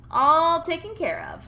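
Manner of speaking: proud. Acted. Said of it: "said Bob proudly".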